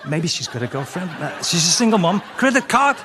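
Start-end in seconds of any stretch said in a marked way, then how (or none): 2.4-3.0 high, loud credit card credit card; mock frantic. Comic mocking himself grabbing his credit card, high and loud. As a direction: scramble for the card eagerly.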